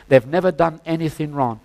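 usual speaking voice

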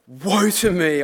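loud, anguished "Woe to me"; dramatic cry. Acted. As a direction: cry it out like a doomed prophet. Reading Isaiah's cry aloud.

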